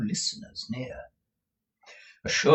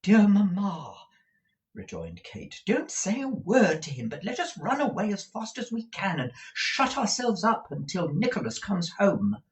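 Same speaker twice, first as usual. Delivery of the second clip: put-on urgent, higher young woman; anxious daughter; 10 semitones higher than usual. A narrator's reading. Daughter urging her mother to flee, a touch anxious. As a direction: plead with mamma to run away.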